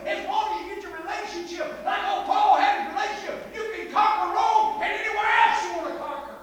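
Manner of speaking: loud, high-pitched pulpit shout; full-force preaching. Real, not acted. Preacher urging the congregation toward a relationship with God, full-force shouting. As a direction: preach it out, building to a driving shout.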